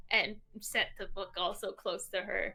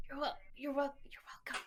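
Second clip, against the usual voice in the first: whispering; 4 semitones higher, 9 dB quieter, faster than usual. Acted. Tiptoeing, not wanting to wake her.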